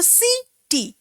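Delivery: "C T" split into two separate beats; syllable by syllable. Shown as a demonstration. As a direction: say city as "C", pause, "T". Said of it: "by a single breath, that is a syllable".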